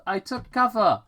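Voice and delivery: calm her dad from cover; reassuring girl. Acted. Paige's voice continues.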